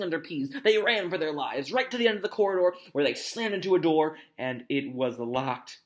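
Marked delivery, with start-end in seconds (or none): none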